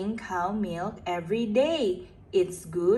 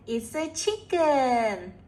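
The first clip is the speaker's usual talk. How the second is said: ordinary delivery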